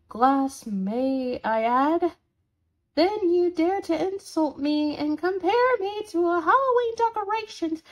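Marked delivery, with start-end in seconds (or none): 3.0-7.8 high-pitched, quick-tempered accusation; indignant. Acted. Roommate listing the offences against them, indignant. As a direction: throw it out as an affronted charge.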